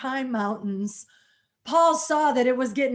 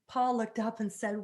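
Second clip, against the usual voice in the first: ordinary delivery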